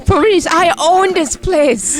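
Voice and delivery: put-on high, drawn-out Vietnamese-accented protest; hammed-up Vietnamese accent, stretched slowly; mock Vietnamese accent. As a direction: imitate an indignant man in a Vietnamese accent. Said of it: "then the Vietnamese guy".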